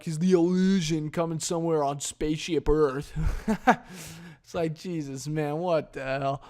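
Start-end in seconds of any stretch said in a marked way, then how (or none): none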